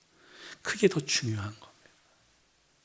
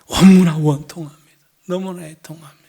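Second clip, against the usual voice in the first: anguished outcry; 3 semitones higher, 14 dB louder than usual. Acted. Hannah pouring out her grief before the priest, loud anguished cry.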